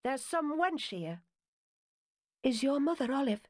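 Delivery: a hint of a wary girl calling back; girl at the door. A narrator's reading.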